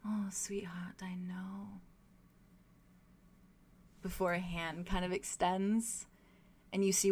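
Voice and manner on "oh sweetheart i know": slow, whispery tenderness; very gentle. Acted. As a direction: soothe them softly, almost in a whisper. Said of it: "very, very gentle".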